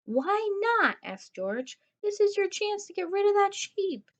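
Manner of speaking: high, loud surprised protest; squeaky protest. Acted. George pleading, surprised.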